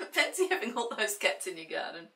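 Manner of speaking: usual speaking voice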